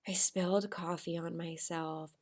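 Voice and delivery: soft, hushed line; whispered. Shown as a demonstration. Teacher replaying her quiet reply. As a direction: murmur it quietly. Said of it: "how I whispered".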